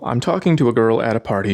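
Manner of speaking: normal talking voice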